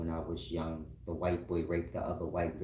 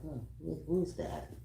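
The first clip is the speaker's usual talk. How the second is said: mock sleepy woman; 8 semitones higher, 4 dB quieter than usual. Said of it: "She's moving around like".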